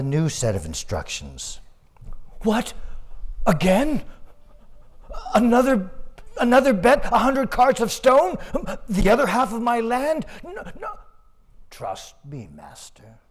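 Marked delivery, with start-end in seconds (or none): 2.4-11.0 loud, high, incredulous questions; shocked disbelief. Acted. Farmer shocked at the new bet. 11.7-13.2 hushed reassurance. Acted. Ox calmly reassures his master.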